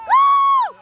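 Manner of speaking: loud high-pitched woo shout; high cheering whoop. Real, not acted. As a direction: whoop with delight. Parent cheers the play.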